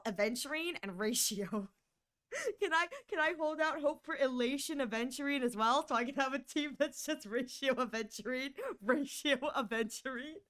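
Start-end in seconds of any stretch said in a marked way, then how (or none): none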